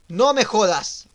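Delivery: loud, high, drawn-out exclamation; shocked outburst. Real, not acted. Player reacting to a game mishap, shocked shout. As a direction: cry out in real disbelief.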